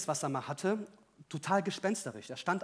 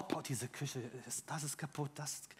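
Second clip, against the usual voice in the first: disgusted grumble; 6 semitones lower, 15 dB quieter than usual. Retold or imitated. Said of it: "Und dann sagte er auch".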